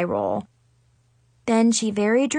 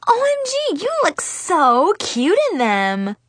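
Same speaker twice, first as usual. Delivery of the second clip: high squeal of adoration; gushing, high-pitched girlish squeal; 9 semitones higher than usual. A narrator's reading. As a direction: squeal as if admiring a new puppy. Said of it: "Zoe squealed like she was admiring a new puppy".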